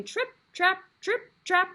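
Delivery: high, sing-song "Trip, trap"; bridge sound effect voice. Acted. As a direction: make the bridge creak high and playful. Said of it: "the bridge is going".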